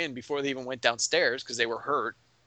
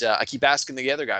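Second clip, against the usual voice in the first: normal talking voice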